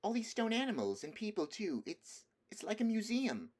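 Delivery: put-on high, bright-eyed girl's voice; a child gasping in wonder; young Lucy amazed at the statues; excited girl crying out. A narrator's reading. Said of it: "cried Lucy".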